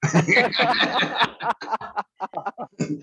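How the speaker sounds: long falling laugh, fading out; real laughter that trails away; drawn-out laugh dying down. Real, not acted. Laughs after the telenovela joke.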